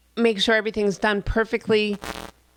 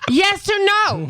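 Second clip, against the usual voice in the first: high, slow, drawn-out insistence; exasperated demand; 10 semitones higher, 3 dB louder, slower than usual. Retold or imitated. Girlfriend pressing boyfriend for an answer, high exasperated demand. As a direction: stretch each word in high-pitched frustration. Exasperated repeat of her question.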